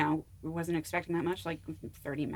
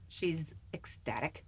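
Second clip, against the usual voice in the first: whisper; 12 dB quieter, slower than usual. Real, not acted. Whispered answer about agent.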